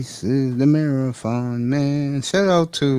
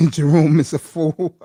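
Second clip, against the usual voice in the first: laughing; 5 semitones higher, 9 dB louder than usual. Real, not acted. Laughing at a friend's joke.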